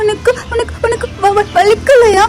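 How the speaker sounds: put-on high, stammering little-child voice, near tears; a scared kid stuttering about the blood; high child voice breaking into a sob; frightened child. Child Dae sees blood, panics.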